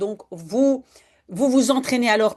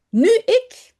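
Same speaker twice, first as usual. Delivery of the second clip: put-on high, louder eager little girl; eager child; 10 semitones higher, 6 dB louder than usual. Janneke eagerly claiming her turn on the slide, high and bright. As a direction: jump in to claim your turn.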